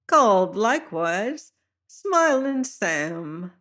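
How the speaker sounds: smiling high-voiced fat seaman; high-pitched voice. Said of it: "high-pitched voice".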